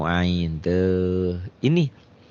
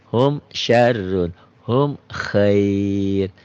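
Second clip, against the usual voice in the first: Quran recitation; much slower than usual. Shown as a demonstration. Recites examples.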